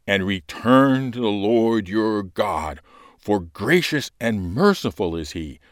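loud, slow, solemn lector delivery; urgent call to return, rising and pausing; proclaimed scripture reading. Acted. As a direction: exhort the people from the ambo.

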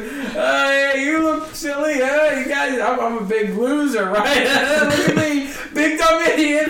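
high, loud mock-desperate stage babble; self-sabotaging crowd work. Out-of-material comic stalls the crowd, mocking himself loudly. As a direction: ham up a comic roasting himself. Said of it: "just sabotaging myself".

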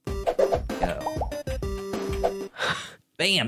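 ordinary talk

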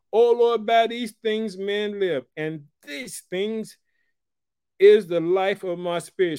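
loud, slow, ringing pulpit reading; preaching at full force. Acted. Preacher declares Hezekiah's words to the church, loud and preached. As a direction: proclaim the lament to the back row.

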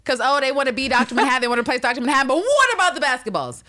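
loud, high-pitched comic tirade; mock outraged rant. Host blurting a silly question about basketballs, mock outrage. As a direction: rattle it off high and loud, building in indignation.

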